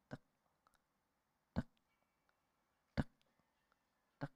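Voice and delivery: soft clicking tick of a clock hand; clock tick imitation. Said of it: "suara jarum jam itu kedengaran".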